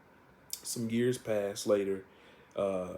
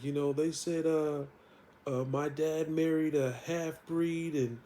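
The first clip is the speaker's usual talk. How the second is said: higher, slow, lightly tearful; a hint of a sobbing kid, drawn out slowly; mild bawling; 6 semitones higher than usual. Sabrina crying to her aunts, lightly tearful and slow. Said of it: "she's just bawling".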